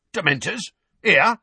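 aghast. A narrator's reading.

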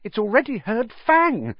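very high panicked protest; pitched far up with fright; unnaturally high voice. A narrator's reading. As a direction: argue back in a panicked squeal. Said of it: "in an unnaturally high voice".

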